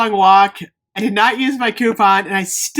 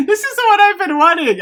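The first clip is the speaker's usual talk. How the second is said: thrilled; 11 semitones higher, 5 dB louder, faster than usual. Real, not acted. Thrilled about a soap scent.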